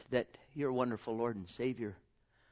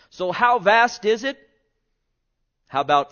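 higher, louder rising preach; full-force preaching; 8 semitones higher, 13 dB louder than usual. Acted. Preacher stirring congregation before John three sixteen, loud. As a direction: swell toward the verse.

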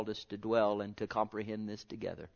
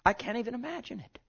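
higher, fast then loud; rising excitement; 11 semitones higher, 17 dB louder than usual. Acted. Preacher building to climax before congregation, high and loud. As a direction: climb to a cry.

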